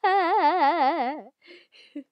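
high, loud, pulsing cackle; loud rippling laughter; wavering loud laugh. Real, not acted. Cracking up during bloopers.

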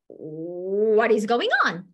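slow, drawn-out bewilderment; baffled teacher. Retold or imitated. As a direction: stretch the question in acted disbelief. Baffled teacher facing crying student.

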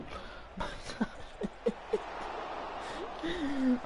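high wheezing laugh; helpless wheeze of a laugh; breathless laugh. Real, not acted. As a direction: silent airy laughter. Reacting to game moment.

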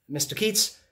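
Keats called up high, rising; calling out. Acted. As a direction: call his name across the room. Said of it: "Uh, Mister Keats?".

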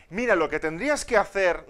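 loud, know-it-all husband giving orders; husband lecturing his wife, loud and bossy; mechanic mode husband. Retold or imitated. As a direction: lay down the fix like a mechanic. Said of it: "nos ponemos en plan mecánico".

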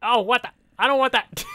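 high, loud outraged protest; startled loud complaint; indignant surprise. Real, not acted. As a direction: object in shocked disbelief. Bad trade result.

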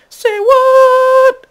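very high, very loud shriek; cartoon amazement at full volume; mock astonished shout. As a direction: screech it in drawn-out disbelief. Amazed tough guys in improvised story.

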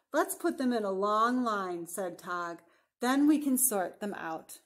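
deliberate picture-book narration, stretching key words; storybook voice with drawn-out line endings; story reading to children. A narrator's reading. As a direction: read it out expressively for young listeners. Reads picture book aloud.

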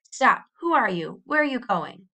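sharp, loud challenge; words cracking like a whip; snapped out. A narrator's reading. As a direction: bark a demand at a stranger. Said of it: "The words snapped out like the cracking of a whip".

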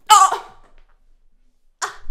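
loud shocked oh; startled cry. Real, not acted. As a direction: gasp it out loud. Shocked exclamation.

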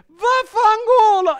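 long high full-voiced shout; urlo a gran voce. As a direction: bellow it out, stretching the vowels. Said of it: "urla a gran voce". (urlato a squarciagola, acutissimo)

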